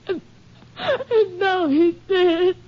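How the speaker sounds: high, slow, sobbing words; breaking into tears. Acted. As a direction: voice cracks as she cries. Sister breaks down over brother.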